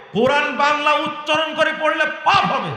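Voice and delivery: heated, high-pitched warning; angry warning. Real, not acted. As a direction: hammer the warning home, pitch held high. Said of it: "রাগ বেশি হয়ে গেছে". (রাগে চড়া গলায় হুঁশিয়ারি)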